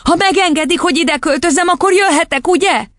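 put-on very high little-girl voice; squeaky childish pleading; eager child. A narrator's reading. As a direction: press hopefully for a yes. Girl eagerly presses teacher.

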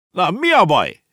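high, loud call up to someone. Acted. Boy to a crying girl up a tree, calling up. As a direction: shout the question upward. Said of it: "kiáltott fel Konrád".

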